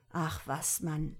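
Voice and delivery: ordinary delivery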